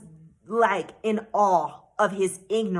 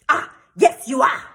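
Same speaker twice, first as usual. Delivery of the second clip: raised-voice impression of an irritated man; flustered, angry official; 2 semitones higher, 7 dB louder than usual. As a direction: cut in with a loud mock-angry contradiction. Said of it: "flustered and angry. He was like".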